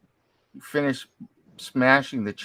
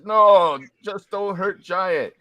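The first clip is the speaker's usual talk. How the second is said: slow, high giant voice; a hint of a dopey giant; dopey cartoon bellow; 7 semitones higher, 5 dB louder, slower than usual. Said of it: "the giant says".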